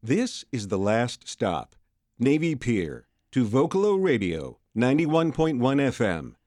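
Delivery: slow, booming transit announcement; train conductor calling. Shown as a demonstration. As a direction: call out the last stop like a train announcer. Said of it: "we'll let you take the wheel".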